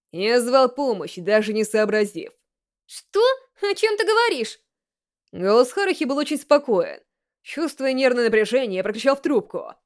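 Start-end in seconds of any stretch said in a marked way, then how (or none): 2.9-4.6 put-on higher, puzzled girl on the phone; girl baffled by a strange call; Haruhi over the phone.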